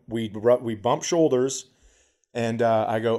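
ordinary talk

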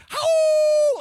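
long, very high wolf howl; imitated howl. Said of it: "he yells a howl at him".

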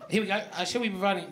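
normal talking voice

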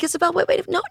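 high, urgent calling after a friend; alarmed plea. Retold or imitated. Trying to stop her friend, urgent and high. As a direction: pitch up in a panic. Retold panic stopping friend.

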